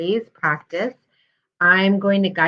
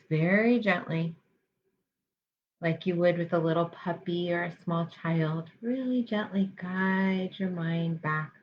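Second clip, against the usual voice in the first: guided meditation. Real, not acted. Health psychologist guiding listeners through mindfulness practice, calm guided meditation. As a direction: lead them gently, leaving space between phrases.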